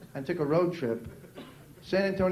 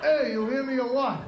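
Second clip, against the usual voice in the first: put-on higher-pitched, pushy Brooklyn accent; 5 semitones higher than usual. Brooklyn guy challenging the comic, pushy New York accent. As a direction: demand attention like a Brooklyn guy. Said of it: "He actually spoke to me like this".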